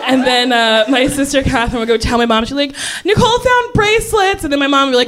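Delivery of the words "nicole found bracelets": put-on high tattling kid voice; tattletale. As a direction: tell on her sister, high. Tattling sister.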